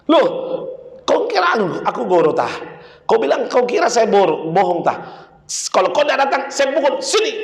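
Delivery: high, scolding father's threat; hammed-up furious father; angry threat. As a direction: threaten the boy to get over here. Father threatens son to come.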